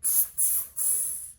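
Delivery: whispered monkey tsking; monkey tss. A narrator's reading. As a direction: tsk again like monkeys. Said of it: "the monkeys only stamped both their feet back at him and said".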